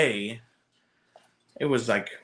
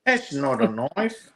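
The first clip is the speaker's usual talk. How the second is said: put-on Australian accent; 2 semitones higher, slower than usual. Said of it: "As, as Brett would say".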